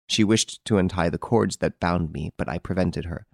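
usual speaking voice

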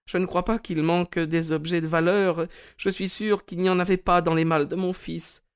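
put-on high, sad old lady voice; a frail lady, smiling sadly; Mrs Maberley. A narrator's reading. Mme Maberley à son conseiller Holmes, voix aiguë et triste.

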